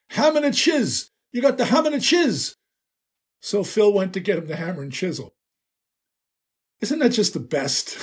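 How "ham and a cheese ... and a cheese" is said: put-on much higher Italian accent, stressing ham. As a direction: repeat it in a heavy Italian accent, miming the tool. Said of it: "an accent you could pour over a plate of spaghetti".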